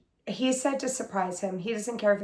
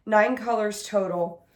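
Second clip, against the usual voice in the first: usual speaking voice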